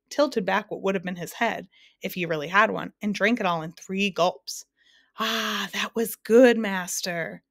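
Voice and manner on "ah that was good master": loud, slow, drawn-out bellow; big satisfied roar. A narrator's reading. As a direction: bellow it out with relish, stretching the words. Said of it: "he bellowed".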